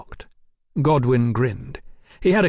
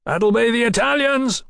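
raised high over the racket; shouted; 11 semitones higher, 3 dB louder than usual. A narrator's reading. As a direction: call out over engine noise. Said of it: "Godwin shouted".

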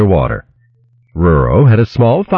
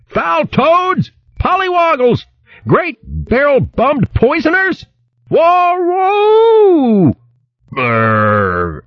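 very high howled insults from a bolting hare; frantic cursing; much slower than usual. A narrator's reading. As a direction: wail curses as you flee, ending in a shudder. Dosed hare bolts away cursing.

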